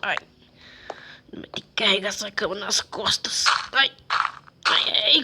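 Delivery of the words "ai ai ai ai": straining groan. Acted. Straining to lift heavy bed.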